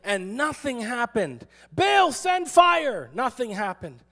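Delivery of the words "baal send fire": high, loud, drawn-out plea; mock shriek. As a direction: imitate worshippers shrieking to their god. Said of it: "they're shrieking in their joy".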